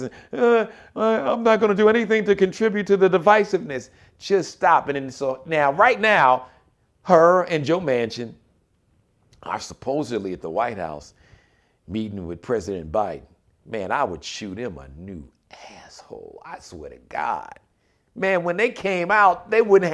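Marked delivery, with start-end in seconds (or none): 0.3-3.9 high, fast mocking of a goofy senator; mocking impression. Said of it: "standing there looking all goofy". 13.7-17.5 drops low and soft, whispering the curse; whispered curse. Real, not acted. Angry aside, drops to whisper.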